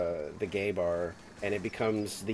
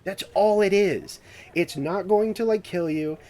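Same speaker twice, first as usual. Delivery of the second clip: the expert; 7 semitones higher than usual. Retold or imitated. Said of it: "and he's like".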